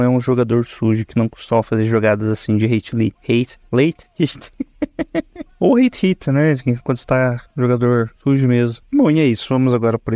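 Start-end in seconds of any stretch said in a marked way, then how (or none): 4.6-5.6 sudden high-pitched laugh; high burst of laughter. Real, not acted. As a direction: break into a short high laugh. Laughs at own slip of tongue.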